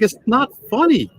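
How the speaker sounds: high-pitched indignant protest; retold upset. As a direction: protest as if mocked, voice jumping up. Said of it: "Now I'm really upset".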